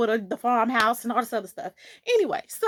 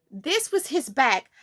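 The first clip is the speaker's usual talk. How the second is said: normal talking voice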